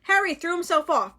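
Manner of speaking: ordinary delivery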